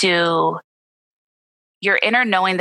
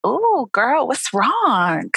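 put-on higher, sassy "ooh, girl"; playful best friend; 6 semitones higher, much slower than usual. Shown as a demonstration. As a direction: talk to yourself like a teasing girlfriend. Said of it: "the way I kinda do it, I'm just like".

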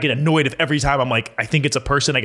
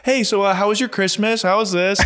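chatty robot operator; 6 semitones higher than usual. Pretend. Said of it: "you start having a full conversation".

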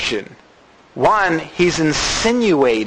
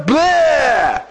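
whiny bleh; 9 semitones higher than usual. Pretend. Gideon's pathetic reaction.

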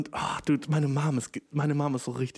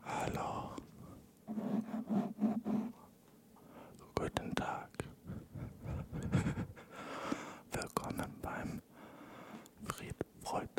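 soft breathy ASMR whisper into the mic; drawn-out mock ASMR whisper; 8 semitones higher, 14 dB quieter, much slower than usual. Shown as a demonstration. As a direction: whisper slowly and close, like an ASMR video. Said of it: "Okay, das war Cocksucking".

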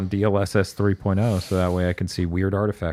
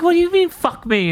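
much higher, louder indignant squawk; mock outraged squeal; 5 dB louder than usual. Retelling a podcast feed fight with Apple, mock outraged squeal. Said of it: "I'm like".

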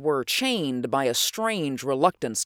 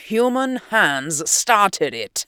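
slow, higher and louder in helpless rage; slow furious outburst; 6 semitones higher, 5 dB louder, slower than usual. Acted. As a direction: spit it out, shaking with fury. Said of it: "quivered with helpless rage".

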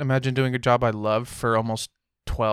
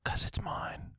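whisper; 10 dB quieter, slower than usual. Real, not acted. Defensive reply to housemate.

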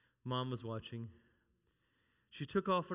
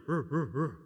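playful power grunts; 3 semitones lower, 4 dB louder than usual. Retold or imitated. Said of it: "I'd go".

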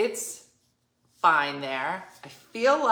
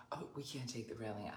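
hushed quoted protest; 8 semitones lower, 18 dB quieter, faster than usual. Retold or imitated. Quoting Wendy's protest.

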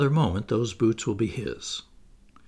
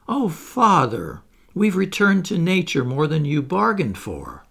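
unplacated; 7 semitones higher, 6 dB louder than usual. Acted. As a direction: refuse to be soothed. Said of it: "scarcely to be placated".

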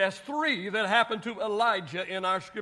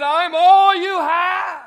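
loud, slow, put-on whine; hammed-up complaint; mock whiny shout; 6 semitones higher, 10 dB louder, slower than usual. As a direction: shout it like a sulking prophet. Preacher voices Elijah's complaint.

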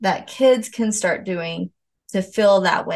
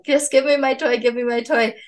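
higher-pitched mild mock yell of a child; half-hearted kid screaming, voice raised in pitch; 5 semitones higher than usual. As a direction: imitate a kid demanding a toy, pitched up. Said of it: "be screaming at Tavery".

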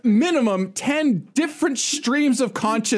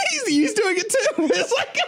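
laughing; 13 semitones higher than usual. Real, not acted. Laughing at contagious chaos.

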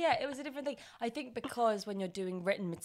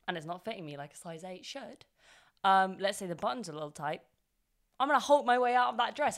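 ordinary delivery

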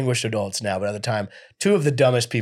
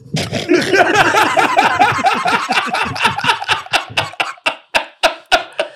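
high, loud burst of laughter; cracking up hard; big laugh; 5 dB louder than usual. Real, not acted. Long laugh after a joke.

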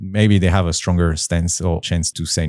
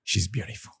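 hushed, much softer retold wonder; smitten awe; 3 semitones higher, 14 dB quieter than usual. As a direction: murmur it like a boy struck at first sight. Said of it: "and he's like".

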